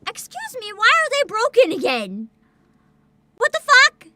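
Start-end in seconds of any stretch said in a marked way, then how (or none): 3.4-4.1 sudden high loud yelp; shocked outburst. Real, not acted. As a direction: blurt it out in shock. Stream link broke again.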